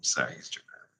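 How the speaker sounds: low breathy whisper, apologetic; whispered aside. Real, not acted. Apologizing to listeners for the melodramatic vampire, whispered.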